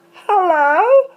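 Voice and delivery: high, wailing comic greeting with swooping stretched vowels; silly character voice. Shown as a demonstration. Introducing Little Wee Wee to viewers, silly high wailing voice. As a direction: call out in a silly singsong character voice. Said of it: "some voices I can do".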